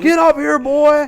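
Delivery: loud, high belligerent shout; imitated screaming stranger. Imitating a bus passenger ordering a man up, screaming. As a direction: yell like a man picking a fight. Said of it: "at the top of his lungs, he's screaming".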